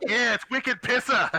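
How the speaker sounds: put-on Boston accent. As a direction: lean into a thick Boston accent. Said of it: "the Vistani speak with a Boston accent".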